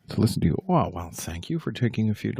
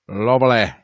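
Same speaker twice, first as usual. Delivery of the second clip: "Lovely" with a British vowel, louder; mock British lovely; 7 dB louder than usual. Shown as a demonstration. Streamer repeating the word in his fake British accent. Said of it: "bloody lovely".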